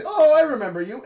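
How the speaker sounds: high, loud, fake recognition; mock surprised greeting. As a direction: greet a stranger like an old friend. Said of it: "maybe we'll say".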